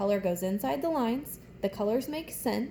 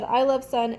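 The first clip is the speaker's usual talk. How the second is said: louder read; 2 semitones higher, 7 dB louder than usual. Shown as a demonstration. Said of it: "So I said".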